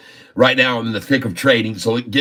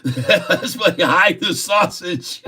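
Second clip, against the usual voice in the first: higher, slow words through real laughter; laughing hard; 6 semitones higher, slower than usual. Real, not acted. Streamer telling viewers a sausage joke, laughing hard. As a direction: struggle to get it out while laughing. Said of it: "I laughed so hard just then".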